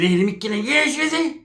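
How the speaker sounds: high, odd mock voice; Pepe. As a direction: imitate him with the pitch leaping up at the ends. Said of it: "that's like if Pepe said".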